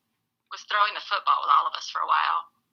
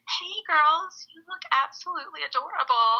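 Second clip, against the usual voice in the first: 1 sweet greeting; 9 semitones higher than usual. Retold or imitated. Diane Keaton voiced in story.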